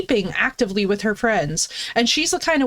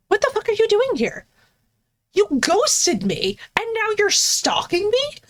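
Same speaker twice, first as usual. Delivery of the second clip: retold angry outburst; 7 semitones higher than usual. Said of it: "she's literally healthily pissed".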